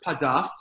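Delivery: Padaf said as a Hebrew-style word; mock Hebrew. Shown as a demonstration. Comic after the PDF line, Hebrew-infused Padaf. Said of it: "No, that's, that's not right".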